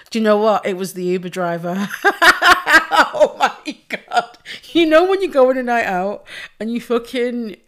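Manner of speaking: usual speaking voice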